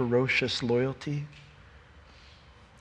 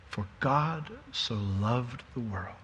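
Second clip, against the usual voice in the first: slow, soft and lower scripture quote; hushed reverence; 4 semitones lower, 11 dB quieter, slower than usual. Acted. Preacher quoting Jesus to Nicodemus, hushed and reverent. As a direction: let the verse land softly, unhurried.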